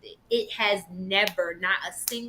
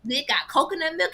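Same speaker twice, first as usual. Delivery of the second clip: ordinary talk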